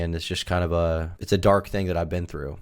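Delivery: normal talking voice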